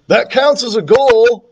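put-on high, excited boyish holler. A narrator's reading. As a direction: holler the news to a teammate. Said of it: "Michael hollered".

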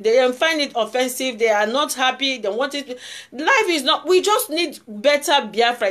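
usual speaking voice

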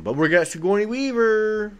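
high, loud, triumphant mock sales pitch; mock excited pitch. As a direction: crow it like a big selling point. Mock studio pitch, climax of quote.

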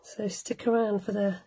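ordinary talk, nothing marked

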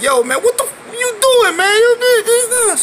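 high, loud angry yelling friend; mad Ito voice. Retold or imitated. Retelling to the crowd how Ito yelled, high and loud. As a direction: squeal it like your angry buddy. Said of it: "He was like".

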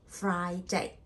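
"Friday" split into FRY and DAY; two separate syllables. Shown as a demonstration. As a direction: break "Friday" evenly in two. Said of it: "two syllable".

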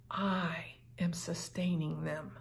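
hushed, breathy whisper of reassurance; God whispering to the persecuted, a soft whisper; whispered God's voice. Acted.

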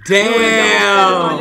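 high, loud exclamation; big drawn-out reaction shout; loud astonishment. Real, not acted. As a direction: holler it in amazement. Host reacting to the voice demo.

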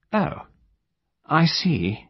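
high, absent-minded lady; vague. A narrator's reading. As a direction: say it high and far away. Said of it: "her manner was vague in the extreme".

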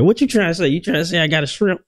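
a hint of hurt pride; offended. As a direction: pretend outrage at the insult. Offended mock reply.

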